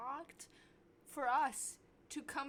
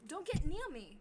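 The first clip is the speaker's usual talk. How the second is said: disgusted outburst; 7 semitones higher, 9 dB louder than usual. Real, not acted. Rant against cigarette breath.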